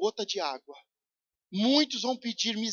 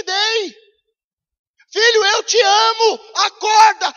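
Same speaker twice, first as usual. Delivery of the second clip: God's shout; 11 semitones higher, 13 dB louder than usual. Acted. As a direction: scream the wake-up call as the Father. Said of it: "é um grito que diz".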